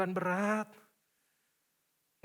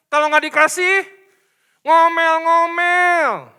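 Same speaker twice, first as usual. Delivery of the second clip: loud mocking of grumbling; 8 semitones higher, 14 dB louder, slower than usual. Preacher mimics grumbling.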